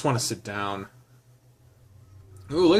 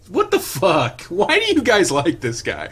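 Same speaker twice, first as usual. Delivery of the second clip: high, amused incredulity breaking into laughter; real disbelief, cracking up at the end; laughing disbelief; 7 semitones higher, 4 dB louder than usual. Real, not acted. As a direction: blurt it out higher, about to laugh. Reader cracks up at the text.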